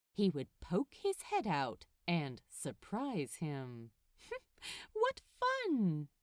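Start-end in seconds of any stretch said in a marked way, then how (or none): 4.3-6.1 much higher, giggling glee; gleeful. A narrator's reading. Crowley plotting his snake prank, giggling glee.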